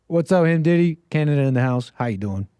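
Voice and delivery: ordinary talk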